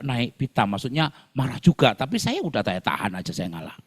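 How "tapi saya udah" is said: usual speaking voice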